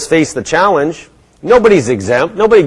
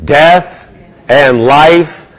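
slow, louder read-aloud of the verse; scripture recitation; 3 dB louder, slower than usual. Real, not acted. Preacher leads the congregation in reciting the verse, slow. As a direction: recite it slowly to lead the congregation. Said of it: "Help me out here".

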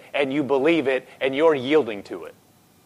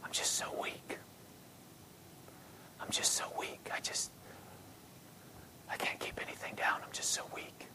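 weak, barely-there whisper; frail voiceless whisper; 23 dB quieter than usual. Retold or imitated. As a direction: whisper it like someone with no strength left. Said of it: "in a whisper".

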